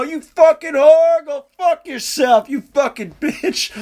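high, drawn-out mock shout, fading at the end; retold window yell. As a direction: holler slow curses out of a car window. Yelling out car window, retold.

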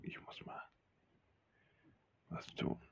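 hushed whisper. Acted. Whispering an excuse to slip away. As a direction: breathe it out without voice. Sneaking off to the toilet.

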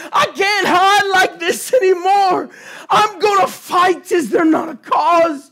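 fiery shouted sermon climax; preaching at full force. Acted. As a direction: preach it hard, rallying the church.

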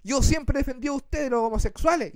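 a hint of a preachy celebrity; lightly voiced self-righteous singer; mild mock lecturing; indignant diva. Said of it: "con ese tono".

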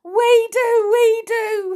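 very high, loud wail; acted sobbing of scared boys; sobbing confession. A narrator's reading. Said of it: "sobbed Len and Tom".

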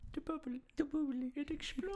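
high squeaky voice. Pretend. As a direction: squeal it like a cartoon. Goofy quote voice.

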